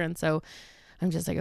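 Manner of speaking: usual speaking voice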